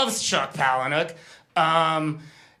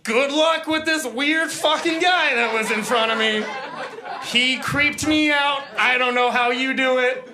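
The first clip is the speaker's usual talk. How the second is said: higher, mock creeped-out author; sarcastic well-wishing, pitched up; imagined author; 5 semitones higher than usual. Imagined author's real thought.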